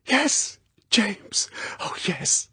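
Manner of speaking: high, breathless relief fading to a whisper; high relieved. Acted. Girl embracing Bond in the tunnel, high relieved then whispered. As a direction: let relief pour out.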